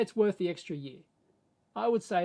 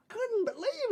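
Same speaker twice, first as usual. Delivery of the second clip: attempted American Southern accent; 14 semitones higher, slower than usual. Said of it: "with the real heavy, you know, Southern accent".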